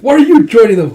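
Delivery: high, loud exasperated question; exasperated cry. Retold or imitated. Friend scolding Hokchi for eating too, exasperated. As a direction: throw up your hands and ask.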